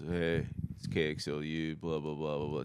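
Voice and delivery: lazy would-be cool college DJ; imitating a bored college radio DJ; cool guy DJ. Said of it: "Just trying to be cool guy".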